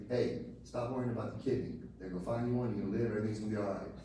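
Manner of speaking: emphatic quick-fire reassurance; blurted reassurance. Retold or imitated. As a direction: blurt out the retold message in one go. Retold blurted reassurance to kidney patient.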